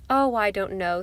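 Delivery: ordinary delivery, nothing marked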